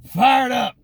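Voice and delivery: "Fired" hit hard, dropping at the end. Real, not acted. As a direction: punch it with leftover energy.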